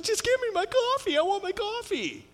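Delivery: very high, whiny mock impatience; impatient customer. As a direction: whine for your coffee. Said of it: "you're just like".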